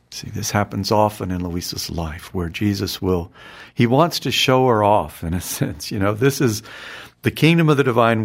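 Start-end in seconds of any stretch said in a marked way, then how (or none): none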